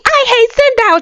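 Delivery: high, whiny mock quote; high mocking whine. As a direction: squeak it out like a sulky hater. Mocking a dusty hater.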